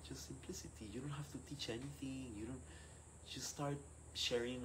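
soft, reassuring questions; gentle priestly counsel. Retold or imitated. Italian priest advising a discouraged young leader, gently. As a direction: counsel softly, coaxing toward simplicity. Acts out priest's kindly advice.

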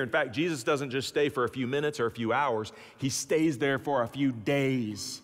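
ordinary talk

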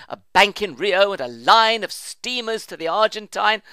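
plain reading voice

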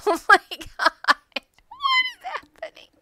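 high, loud shocked cry; shocked yelp. Real, not acted. Gamer shrieking at chaos in the End. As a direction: squeal it in total disbelief.

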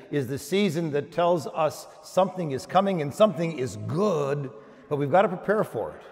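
ordinary delivery

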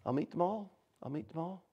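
very soft, fading almost to a whisper; hushed promise. Retold or imitated. Jesus to the women about his disciples, hushed. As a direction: promise it gently under your breath.

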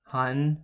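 한국 said as clear Korean Hanguk; Korean word. Shown as a demonstration. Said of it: "The first word that I would like you to try is".

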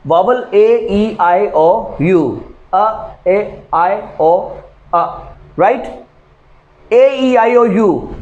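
slow, careful list of vowels; counting off vowels. Shown as a demonstration. Teacher listing vowels to students, slow and deliberate. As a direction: tick each vowel off one by one. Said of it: "listing vowels for students".

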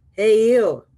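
loud, drawn-out challenging call; grouchy ladybug. Acted. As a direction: call out like a grouchy bug picking a fight. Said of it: "said the grouchy ladybug".

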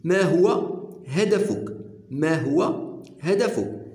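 very slow, deliberate teacher read; slow model phrase. Shown as a demonstration. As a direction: stretch it out word by word for learners. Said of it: "كيلي طون اوبجيكتيف".

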